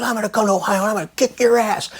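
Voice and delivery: high-pitched mock rage; ticked-off agent. Sports agent threatening the radio host, imitated high anger. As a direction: imitate a furious man sputtering threats. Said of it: "He's so ticked off".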